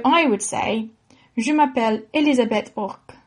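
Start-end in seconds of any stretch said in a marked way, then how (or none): 1.4-3.1 name said with a French accent. Shown as a demonstration. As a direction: introduce yourself in careful French pronunciation. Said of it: "say your own name in a French accent".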